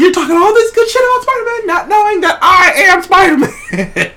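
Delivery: high-pitched teasing brag breaking into a laugh; gleeful gloating. Retold or imitated. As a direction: crow it like a secret hero who can't hold it in. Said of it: "He's like".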